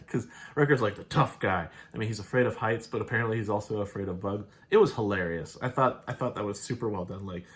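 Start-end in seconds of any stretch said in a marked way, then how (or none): none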